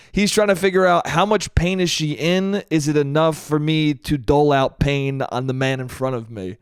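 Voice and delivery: normal talking voice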